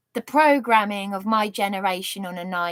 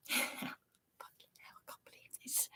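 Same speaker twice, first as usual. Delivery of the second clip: whispered, much softer hell and disbelief; 20 dB quieter than usual. Real, not acted. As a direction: breathe it out under your breath. Swearing under breath in disbelief.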